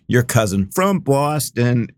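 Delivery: imitating a commercial's Boston accent. Host quoting his favorite beer commercial, Boston accent. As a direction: put on a drawn-out Boston accent. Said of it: "it just starts, uh, it, like this".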